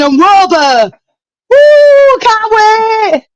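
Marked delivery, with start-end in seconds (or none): 1.5-3.3 high, loud whoop of joy; excited cheer. Real, not acted. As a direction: whoop like a fan who just heard good news. Cheering new signings.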